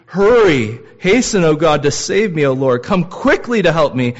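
loud, high, insistent begging; urgent cry to hurry. Acted. Preacher acting out David telling God to hurry, loud and urgent. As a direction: shout at God to come now. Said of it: "You ever tell God to hurry?".

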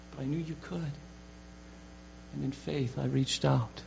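soft, hushed admission; the healed woman stepping out of the shadows, hushed; timid confession. Acted. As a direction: confess it quietly, almost ashamed.

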